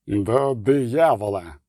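slow, low-key angry hiss; hissed curse. A narrator's reading. Бронібор cursing at closed doors, slow hiss. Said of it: "просичав Бронібор".

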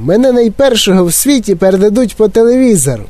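high excited shout while running. Acted. Mike shouting to everyone as he runs. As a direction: yell it, showing off.

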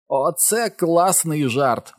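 high, drawn-out cheer; hollering. A narrator's reading. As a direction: crow it slowly in triumph. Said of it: "волав Річі".

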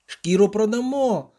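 high strained exclamation; keyed-up poet's high call; excited crowing. A narrator's reading. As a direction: cry it out gleefully while hauling. Straining poet crows over catch.

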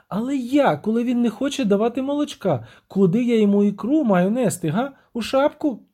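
high-voiced siren, reproachful; indignant siren. Siren scolds her lover for sacrificing nothing, high reproach. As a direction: complain in a high, lilting voice.

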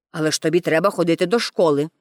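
low, brisk grown-up voice; insistent policeman. A narrator's reading. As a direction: press the point quickly in a low voice. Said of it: "наполягав поліцай". (наполегливий низький голос поліцая)

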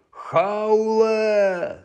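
long high howl of a name. A narrator's reading. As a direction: howl it out on one sustained note. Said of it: "завив він".

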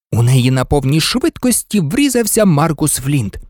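usual speaking voice

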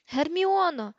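high, loud cry for someone out of reach; bellowing. A narrator's reading. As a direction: yell the name out in desperation. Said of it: "загорлав Рон".

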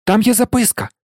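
put-on a boy suddenly spotting a note; sudden shout. A narrator's reading. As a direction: blurt it out as you spot it. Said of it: "раптом вигукнув Рон".

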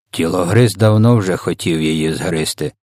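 ordinary delivery

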